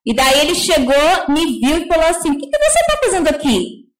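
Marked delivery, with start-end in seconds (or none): 2.3-3.9 hostile challenge. Retold or imitated. Supervisor confronta em evento.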